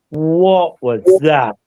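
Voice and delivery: very slow, drawn-out retold astonishment; long, slow mock-stunned question; startled group reaction. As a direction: imitate stunned people, stretching each word right out. Retold group's shock at loud breath.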